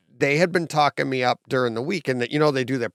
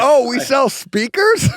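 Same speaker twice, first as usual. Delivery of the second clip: mock surprise; 12 semitones higher, 6 dB louder, slower than usual. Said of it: "joking imitation of Gary".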